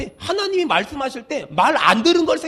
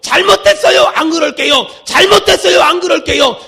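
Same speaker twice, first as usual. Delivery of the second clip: loud, driving pulpit declamation; full-force preaching; 7 dB louder than usual. Real, not acted. Preacher urging the congregation to repent, loud fervent preaching. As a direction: hammer out the repeated confession and build to a declaration.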